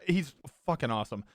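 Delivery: ordinary talk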